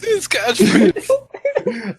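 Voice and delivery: slow words broken by real laughter; laughing. Real, not acted. Cohost laughing at the guest's catchphrase. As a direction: crack up mid-phrase.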